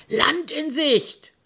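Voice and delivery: very high, drawn-out lookout call. A narrator's reading. Sailor spots the island, calling out high. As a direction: sing it out from the mast. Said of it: "rief einer".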